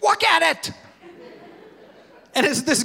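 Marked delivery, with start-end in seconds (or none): 0.0-0.7 put-on upset toddler. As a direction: cry it out like an alarmed two-year-old. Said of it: "he's concerned".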